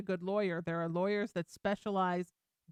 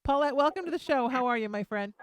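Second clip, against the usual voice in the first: bright, louder host greeting; on-air welcome; 3 semitones higher, 7 dB louder, faster than usual. Acted. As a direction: welcome the caller like a radio host.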